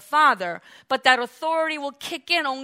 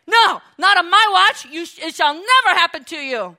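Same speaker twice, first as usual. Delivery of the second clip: loud, higher, indignant refusal; Peter protesting loudly; 3 semitones higher, 6 dB louder than usual. Acted. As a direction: cut him off with a loud protest. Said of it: "here is Peter saying".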